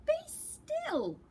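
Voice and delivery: high, loud command. Acted. Max taming the wild things, high commanding call. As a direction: freeze the monsters with one order. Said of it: "Till Max said".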